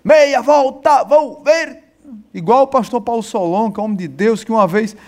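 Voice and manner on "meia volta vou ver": drill command. Retold or imitated. Imitating army corporal's drill command.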